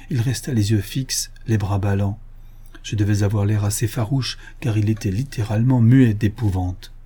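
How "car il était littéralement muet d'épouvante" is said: usual speaking voice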